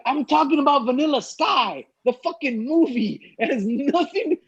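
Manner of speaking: high, exasperated retelling; pleading that it was just the movie; exasperated protest. Retold or imitated. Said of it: "and I'm like".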